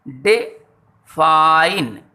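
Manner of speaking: Define in two parts, a short "de", then a long "fine"; two-syllable demo. Shown as a demonstration. Teacher showing pupils two syllables, define slowly split apart. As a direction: stretch the last syllable of define, pausing after the first. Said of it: "यहाँ ये दो syllable".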